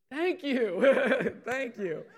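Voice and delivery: loud, high laughing thank you; grateful burst at last; cheering the one who answered; relieved thanks. Real, not acted. Someone finally answers the question.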